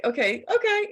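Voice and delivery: high, breezy okay okay; mocking her okay. Friend teasing the coach's stock phrase, high and breezy. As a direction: tease her catchphrase lightly. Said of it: "you always say, like".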